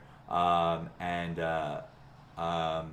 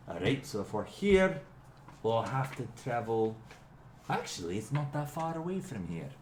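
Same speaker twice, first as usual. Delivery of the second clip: a hint of Morgram, a bit higher, musing over the map; lightly voiced companion; 4 semitones higher than usual. Morgram studies the map, lightly voiced. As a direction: think aloud while reading the map.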